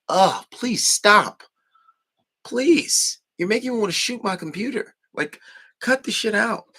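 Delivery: loud high "please stop" in disgust; exasperated disgust. Retold or imitated. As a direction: groan at it like it hurts to watch. Venting disgust at the video.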